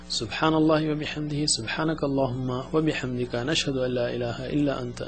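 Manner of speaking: quick, soft murmured Arabic prayer; recited prayer. Real, not acted. Teacher closes the lesson with prayer, quick soft recitation. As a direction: run through the closing supplication softly and fast.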